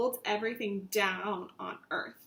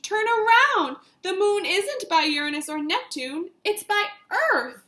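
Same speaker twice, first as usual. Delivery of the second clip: high, loud urgent directions; urgent excitement; 8 semitones higher, 7 dB louder than usual. Acted. Marty guiding the comet, high and loud. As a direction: steer the comet home with urgency.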